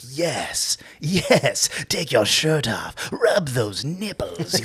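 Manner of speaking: breathy whisper rising to slow, loud urging; leering director. Retold or imitated. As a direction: egg them on, lecherous and slow.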